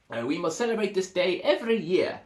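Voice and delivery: high, loud, eager elephant voice; another elephant. Acted. Another elephant proposes the celebration, high and eager. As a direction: announce it proudly. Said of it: "said another elephant".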